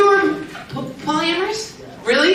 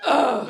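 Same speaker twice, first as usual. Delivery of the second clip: loud mock "ugh"; exaggerated scoff of annoyance; imitated disgusted scoff; 6 dB louder than usual. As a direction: huff out an exasperated groan. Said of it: "you turn like this".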